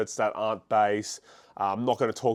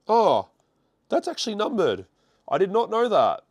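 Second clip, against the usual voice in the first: high, startled realisation; surprised; 9 semitones higher than usual. Real, not acted. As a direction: genuinely caught out by the card. Discovers a card is numbered.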